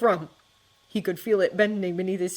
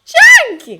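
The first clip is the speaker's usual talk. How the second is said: put-on high, much louder child's shriek; girl shrieking; 20 dB louder than usual. A narrator's reading. Little sister shrieking while chased over a hill. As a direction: shriek his name as a giggling little girl. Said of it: "a little girl shrieked".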